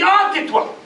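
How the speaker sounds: high, loud snap; sharp rebuke. Acted. Judge silencing the merchant, high loud snap. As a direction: cut him off cold.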